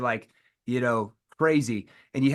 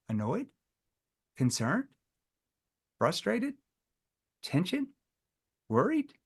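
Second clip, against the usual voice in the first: soft rising questions; confused echo; 3 semitones higher, 5 dB quieter than usual. Shown as a demonstration. Salesman teaching the confused echo of emotion words. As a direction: echo each word, puzzled. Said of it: "in a confused tone".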